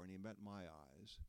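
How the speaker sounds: plain reading voice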